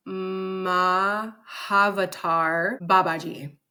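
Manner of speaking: "Mahavatar" said slowly, syllable by syllable, then "Babaji" after a pause; tentative "Mahavatar ... Babaji", checking each part; unsure pronunciation. Real, not acted. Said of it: "I am not gonna know how to pronounce this".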